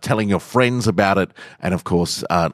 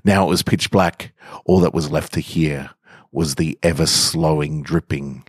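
story narration; 3 semitones lower than usual. A narrator's reading. Podcast host reading a macabre story to listeners, measured narration. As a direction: draw listeners into a grim tale with even pacing. Reads a dark story aloud.

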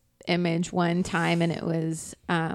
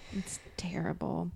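whispered; 14 dB quieter than usual. Real, not acted. Embarrassed aside.

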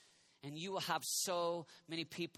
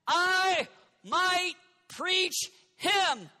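shouted preaching, higher, drawn out; 9 semitones higher, 8 dB louder, slower than usual. Acted. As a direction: build the sermon to a peak. Preaching build.

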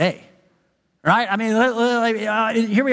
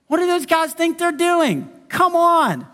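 high, scornful jeer; mocking; 8 semitones higher, slower than usual. A high mocking sneer. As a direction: Sanballat mocking the wall builders, high and scornful. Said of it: "he starts mocking them".

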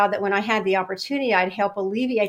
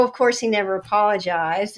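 ordinary delivery

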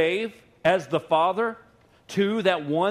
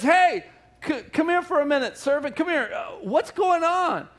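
calling out; 11 semitones higher, 4 dB louder than usual. Retold or imitated. Older son calling a servant over, loud and high. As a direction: shout for the servant across the yard.

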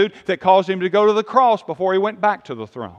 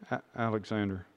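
timid mumble; 11 semitones lower, 17 dB quieter, faster than usual. Retold or imitated. Junior officer mumbles name to general.

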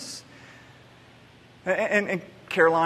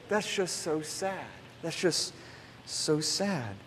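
hushed, slow, soft repetition of so sad; quiet sad child; 3 semitones lower, 12 dB quieter, slower than usual. Retold or imitated. Father quoting his daughter, hushed and slow. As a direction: softly echo a little girl's sorrow. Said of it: "she kept saying that".

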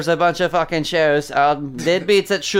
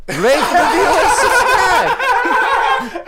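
squealing sign-off; 4 dB louder, much slower than usual. Acted. Hammed-up plug sign-off.

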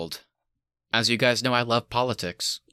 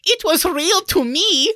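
very high, slow, hammed-up Russian accent; Florence Pugh's Russian accent; 6 dB louder, slower than usual. Podcaster mimicking a favorite film line, squeaky mock Russian accent. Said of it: "whenever she was like".

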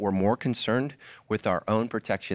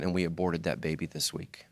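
hushed confession; 6 semitones lower, 5 dB quieter than usual. Retold or imitated. Crying young man confessing.

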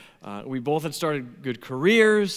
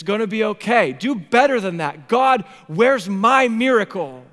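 loud, high, pleading protest; impassioned shouting, each demand hammered out; anguished outcry; 6 semitones higher, 7 dB louder than usual. Acted. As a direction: rail at God in hurt and frustration. Acted outcry to God over childlessness.

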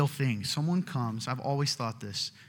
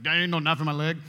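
a lightly put-on goofy voice, a bit louder; mock stubborn denial; hammed-up stubborn person; silly denial voice; 4 dB louder, faster than usual. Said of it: "I don't know why they sound that way".

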